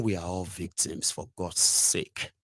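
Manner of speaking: a soft exasperated whisper; whispered exasperation. Real, not acted.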